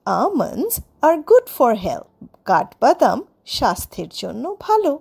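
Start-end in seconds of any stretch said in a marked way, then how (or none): 0.1-2.1 slow, clear example sentence. Shown as a demonstration. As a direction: read it out slowly for learners. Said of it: "slow teaching example". (ধীরে ধীরে উদাহরণ বাক্য)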